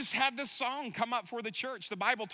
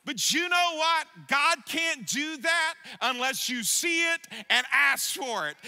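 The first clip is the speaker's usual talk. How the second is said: loud praise, higher; fervent preaching; 4 semitones higher, 4 dB louder than usual. Acted. Pastor voices the church's praise, loud and fervent. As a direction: proclaim it joyfully.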